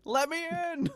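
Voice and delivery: high eerie ghost plea; spooky high ghost voice. Pretend. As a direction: creep in like a ghost at the door. Spooky voice at door.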